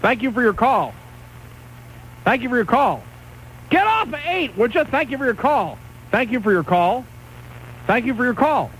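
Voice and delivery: mock-polite hang-up line, over and over; curt brush-off. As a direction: rattle off a fake-polite goodbye to each caller. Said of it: "I'll just hang up on everybody right now and be rude".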